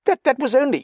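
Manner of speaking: flustered protest. Acted. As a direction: stammer it out in a high fluster. Flustered Dallings tries to explain himself.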